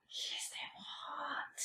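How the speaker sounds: slow whisper, barely voiced; hushed admiration. Friend whispering about a celebrity she likes, hushed admiration. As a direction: whisper it slowly as a very soft aside.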